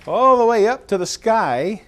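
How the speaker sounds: loud and high, building wonder; excited. Pretend. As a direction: reach up to the sky with wonder. Stretching tower to the sky.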